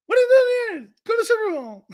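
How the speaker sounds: high, loud puppet squeak; Tony Romo. Acted. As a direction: squawk like a ventriloquist's dummy. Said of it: "like a ventriloquist".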